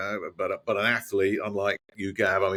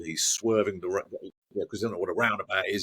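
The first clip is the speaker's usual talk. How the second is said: normal talking voice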